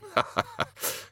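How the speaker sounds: breathy, unvoiced nervous chuckle; nervous laugh. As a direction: laugh it off, uneasy. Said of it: "he laughed nervously".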